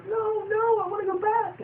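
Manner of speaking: very high frightened whine; scared squeak. Retold or imitated. Scared pet begging to go back, very high whine. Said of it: "he's all scared".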